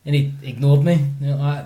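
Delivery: ordinary talk, nothing marked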